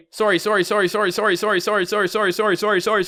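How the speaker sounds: "sorry" repeated rapidly on one high pitch, like a loop; rapid-fire sorries. Shown as a demonstration. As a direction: rattle off "sorry" nonstop, high and even. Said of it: "listen to you say sorry all day".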